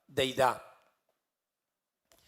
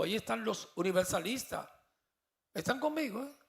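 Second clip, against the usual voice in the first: preaching at full force, pitched higher; anointed preaching voice; 4 semitones higher than usual. Real, not acted. Preacher tells the congregation the Spirit gives him this voice, fervent preaching. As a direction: proclaim it with fire from the pulpit. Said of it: "Hasta el tono de la voz".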